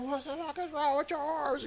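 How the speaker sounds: high-pitched panicked shriek; shrill freaked-out outburst; freaking out. Retold or imitated. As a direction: yelp it like you just saw something horrifying. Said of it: "He just starts freaking out".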